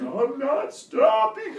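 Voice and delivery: drawn-out, laughing high voice; high slow declaration. Retold or imitated. Little brother running off, high and drawn out. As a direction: stretch it out like a kid fleeing.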